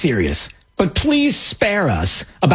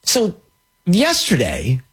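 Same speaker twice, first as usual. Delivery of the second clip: normal talking voice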